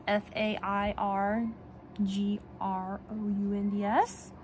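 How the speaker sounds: slow letter-by-letter spelling; spelled out. Real, not acted. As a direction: spell it out carefully so the dog won't catch it. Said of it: "I can't say the words".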